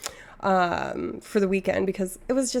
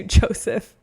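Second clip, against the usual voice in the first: ordinary talk, nothing marked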